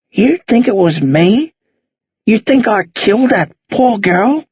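slow, drawn-out dismayed sighing words; appalled, wounded questioning; lightly voiced shocked old man. Old man learning he is accused, slow dismay.

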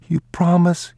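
put-on a scared boy needing a promise; pleading boy. The boy begs his father for a promise, high and anxious.

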